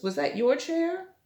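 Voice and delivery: plain reading voice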